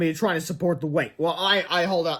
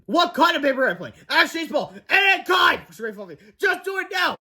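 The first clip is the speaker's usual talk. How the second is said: high, loud urgent yelling; shouting orders in a panic; frantic shout; 10 semitones higher, 3 dB louder than usual. A narrator's reading. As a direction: bark the order before they crash. Frantic order while falling.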